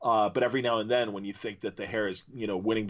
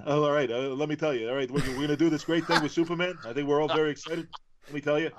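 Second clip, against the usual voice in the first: quick, slightly higher Jackie Mason style patter; Dan DiDio impression; 4 semitones higher, faster than usual. Host imitating the DC executive for his co-hosts, fast comic patter. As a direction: rattle it off like an old borscht-belt comic. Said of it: "Dan DiDio speaks like this".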